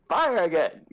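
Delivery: high, falling battle cry; commanding call. Acted. Commander ordering another fireball, high commanding call. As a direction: call out the order with pitch jumping up.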